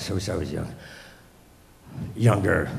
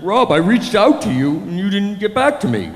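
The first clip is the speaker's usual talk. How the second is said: hammed-up young person's plaintive voice; dramatic young person; 6 semitones higher than usual. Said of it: "these people use dramatic phrases now".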